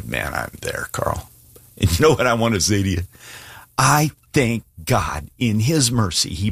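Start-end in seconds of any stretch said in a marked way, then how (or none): 0.0-1.3 breathy whisper, barely voiced; a driver whispering to the preacher that he has hit bottom; whispered confession. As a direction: confide it under your breath, low. Listener confessing while driving.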